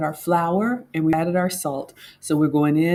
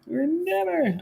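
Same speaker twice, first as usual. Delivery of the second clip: "We're never" jumps much higher and softer; mock despair; 12 semitones higher, 5 dB quieter, slower than usual. Host struggling with dough, high mock wail. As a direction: wail it lightly, falling at the end.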